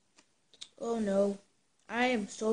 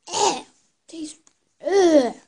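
disgusted groan; 5 semitones higher, 8 dB louder, much slower than usual. Real, not acted. Still reacting to moldy cheese.

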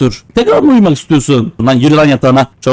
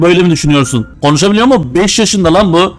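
ordinary delivery

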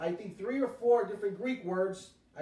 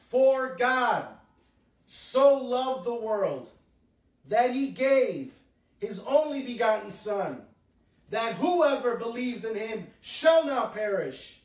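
louder, slow, weighty reading of the verse; solemn scripture recitation; 5 dB louder than usual. Acted. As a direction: proclaim each phrase with long pauses. Preacher recites John 3:16.

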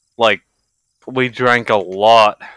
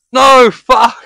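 high, loud burst of dismay; sudden shout; 12 semitones higher, 8 dB louder than usual. Real, not acted. Streamer yelling at chat in sudden dismay. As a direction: yelp it out, caught off guard.

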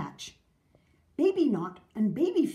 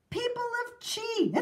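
mayor's public address; 13 semitones higher than usual. A narrator's reading. Mayor opening a town meeting, high proclamation. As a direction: call the crowd to attention, pitched high.

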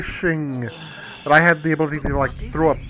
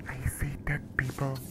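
breathy movie-quote whisper; spooky whisper; 11 dB quieter than usual. Pretend. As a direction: whisper it like a ghost story. Quoting the famous movie line.

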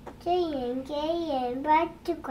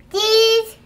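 excited shout; 7 semitones higher, 12 dB louder than usual. Real, not acted. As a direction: shout it out, pointing. Toddler pointing at picture.

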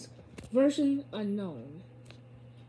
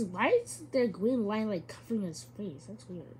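ordinary talk

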